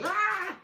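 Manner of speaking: high loud excited yelp; mock bark. Retelling his dog running up to strangers, with a loud high yelp. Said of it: "He'd go".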